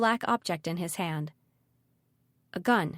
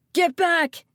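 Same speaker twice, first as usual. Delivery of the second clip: high, loud, sudden cry; yelled warning; 9 semitones higher, 7 dB louder than usual. A narrator's reading. Malachi warns Ridley away from the gun, yelled. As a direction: yell it high to warn her off. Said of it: "Malachi yelled".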